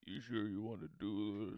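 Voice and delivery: low, tough guy impression; Dom voice. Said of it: "He does the classic Dom look over".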